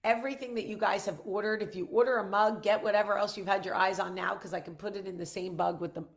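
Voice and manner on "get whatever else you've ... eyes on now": ordinary delivery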